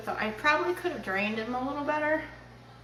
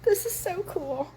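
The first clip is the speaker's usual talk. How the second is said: delighted; 8 semitones higher, 5 dB louder, slower than usual. Real, not acted. Delighted at result.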